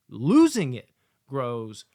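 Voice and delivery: loud, slow, heavy stress on losing; punched emphasis. Real, not acted. Reviewer stressing the mounting risk, slow and loud. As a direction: lean hard on losing, slowly. Building tension of the story.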